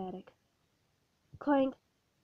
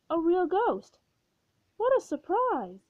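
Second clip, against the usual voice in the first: delighted exclamation; 6 semitones higher, 4 dB louder than usual. Acted. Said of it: "he exclaimed".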